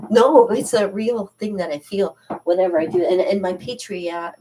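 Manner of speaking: normal talking voice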